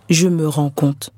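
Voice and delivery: ordinary delivery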